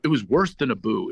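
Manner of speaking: normal talking voice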